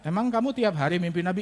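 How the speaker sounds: ordinary talk, nothing marked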